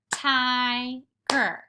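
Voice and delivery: tiger split into its two syllables; teacher splits tiger into syllables for kids; syllable demo. Shown as a demonstration. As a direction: say tiger in two clear beats. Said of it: "two syllables in tiger".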